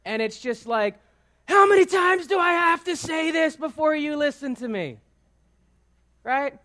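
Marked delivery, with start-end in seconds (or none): 1.5-5.0 exasperated shout, pitched way up; raised-voice parent outburst. Acted. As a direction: yell it like a fed-up parent. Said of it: "all of a sudden our voice is raised".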